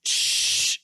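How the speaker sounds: one long, steady "shh"; drawn-out shushing sound. Shown as a demonstration. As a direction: hush someone with a sustained hiss. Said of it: "Cuando tú dices".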